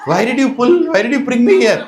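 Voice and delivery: high, loud whine of complaint; protesting child. Retold or imitated. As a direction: protest like a boy dragged along. Boy protests to his mother.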